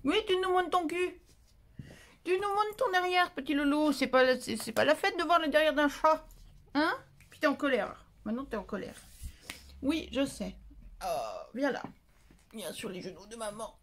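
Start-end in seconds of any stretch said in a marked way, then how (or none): 0.1-6.9 higher, louder sing-song cat talk. Real, not acted. To the cat showing its behind, high teasing baby talk. 11.0-13.8 softer, lower, gentle coax; tender coaxing. Real, not acted. Inviting the cat onto mother's lap, soft and tender.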